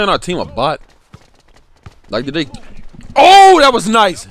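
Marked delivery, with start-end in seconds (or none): 3.2-4.2 loud, high, slow cheer; real burst of delight at the screen; excited praise. Real, not acted. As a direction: hype up the play. Reacting to a good play.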